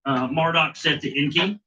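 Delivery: put-on measured, solemn reading of an old text; formal story reading. Host reading the Enki tablets to his co-host, solemn recital. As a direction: read it out like scripture.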